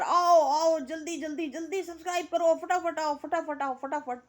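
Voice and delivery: high, urgent imitation of a streamer hollering; mock yelling. Vlogger mocks a streamer begging live viewers to subscribe, mock yelling. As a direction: mimic her shrieked hurry-up, loud at first then held high. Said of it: "चिल्ला चिल्ला कर".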